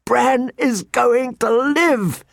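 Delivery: slow, high-pitched jubilant whoop. A narrator's reading. Jon spins Tyrion round with the news, whooping. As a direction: whoop it out high and long, overjoyed. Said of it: "he whooped".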